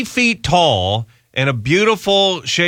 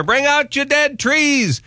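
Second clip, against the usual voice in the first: high, slow town crier call; cart collector; 13 semitones higher, slower than usual. Retold or imitated. Host mimicking the Holy Grail corpse collector calling out. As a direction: sing out the call like the plague cart man.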